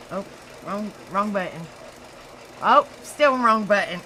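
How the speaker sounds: ordinary talk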